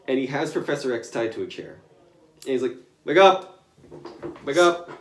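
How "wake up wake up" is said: high, loud shouted wake-up; yelling. Retold or imitated. Deadpool yelling at Professor X, shouting. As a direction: shout him awake.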